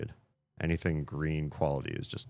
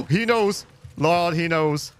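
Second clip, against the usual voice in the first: high gasping panic; panicked gasp; 13 semitones higher, 9 dB louder, slower than usual. Real, not acted. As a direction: gasp and panic as he spots you. A monster spots the player.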